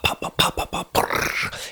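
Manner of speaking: soft mimicked popping and bubbling, fading to a brrr; popping noises. Shown as a demonstration. Describing boiling pasta water, imitating soft pops. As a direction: make the water pop, pop, pop, then brrr. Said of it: "I'm dramatizing".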